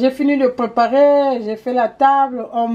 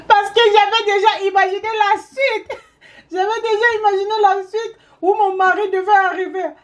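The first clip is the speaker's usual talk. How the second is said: higher, words squeezed through laughter; real laughter breaking the words; laughing while talking; 9 semitones higher than usual. Real, not acted. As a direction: tell it while you can't stop laughing. Laughing at the story.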